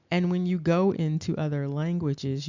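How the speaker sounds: ordinary talk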